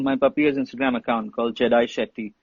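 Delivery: ordinary talk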